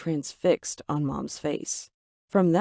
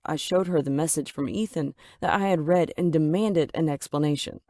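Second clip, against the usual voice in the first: ordinary delivery, nothing marked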